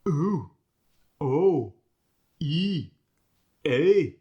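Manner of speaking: "Ooh, O, E, A." each swooping upward; internal vowel lilt. Shown as a demonstration. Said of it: "internal vowel lilt going upward".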